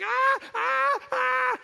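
piercing high screams of a panicked flyer; shrill hammed-up airplane screams; imitated terrified screams. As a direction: scream like you're terrified of flying. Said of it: "She's like".

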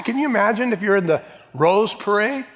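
usual speaking voice

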